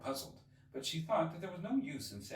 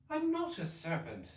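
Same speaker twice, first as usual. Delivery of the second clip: put-on Alice's higher, protesting child voice; indignant; 13 semitones higher, 3 dB louder than usual. Alice protesting the pigeon's accusation, indignant. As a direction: push back, offended. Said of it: "said Alice indignantly".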